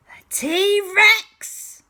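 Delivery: sudden high, loud shout; shouted reveal. A narrator's reading. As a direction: burst out with the big reveal. Sudden reveal after whisper.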